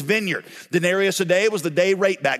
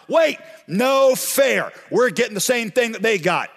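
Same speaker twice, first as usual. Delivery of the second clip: outraged protest; 4 semitones higher than usual. Acted. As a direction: yell it out like you've been cheated. Workers protest their equal pay.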